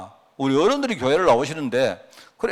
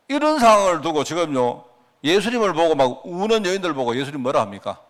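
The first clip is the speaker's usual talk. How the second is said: ordinary delivery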